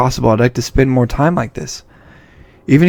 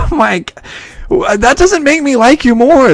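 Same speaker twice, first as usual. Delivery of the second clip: ordinary talk, nothing marked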